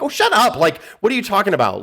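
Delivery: high, dismissive shut up; exasperated. Retold or imitated. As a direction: brush him off sharply. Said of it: "I'm like".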